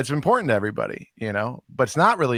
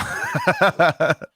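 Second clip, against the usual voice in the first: higher-pitched sheepish laugh; 5 semitones higher, 4 dB louder than usual. Real, not acted. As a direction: laugh off the awkward moment. Laughs after changing the subject.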